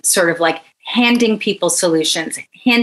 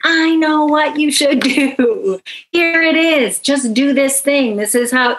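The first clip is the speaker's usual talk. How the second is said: arrogant know-it-all; 7 semitones higher than usual. Retold or imitated. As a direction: lecture them like you have every answer. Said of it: "that's so arrogant".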